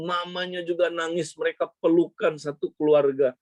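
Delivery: usual speaking voice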